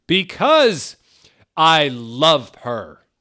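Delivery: loud, slow declaration; dramatic confession. Acted. A character confessing love to the group. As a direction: declare it passionately.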